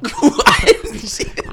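squeaky laugh through words. Real, not acted. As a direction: laugh while talking.

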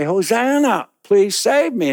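high, pleading cry. Acted. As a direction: beg for rescue. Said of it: "you say".

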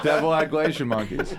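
ordinary delivery

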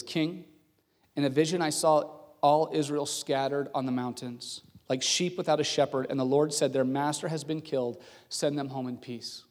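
low, sober prophetic delivery; sarcasm gone, sincere. Acted. Prophet tells the king his vision of defeat, solemn and sincere. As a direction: deliver grave news quietly and earnestly. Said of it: "his tone change and the sarcasm completely leave".

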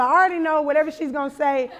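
ordinary talk, nothing marked

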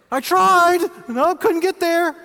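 very high, loud mock-innocent alibi; sheepish excuse. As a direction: squeak out a lame excuse, slowing at the end. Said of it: "I'd be like".

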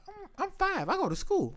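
put-on high, soft small-child voice; imitated little kid. Five-year-old answering where he works, soft high voice.

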